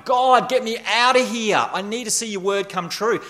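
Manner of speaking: high, pleading cry to God; desperate plea. Retold or imitated. Psalmist crying out to God for rescue, high and urgent. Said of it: "He's not just crying out going".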